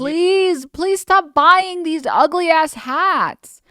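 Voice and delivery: high, slow, hammed-up plea; mock pleading. Mocking hat sellers, a slow high pleading voice. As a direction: beg like a cheesy influencer, stretched out.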